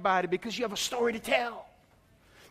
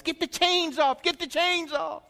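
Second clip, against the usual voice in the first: retold scream; 9 semitones higher than usual. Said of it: "he was screaming all night".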